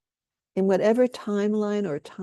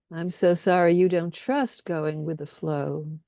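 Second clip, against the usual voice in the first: guided compassion statements. Acted. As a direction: lay each statement down gently, leaving room for echoes. Leads group in compassion statements.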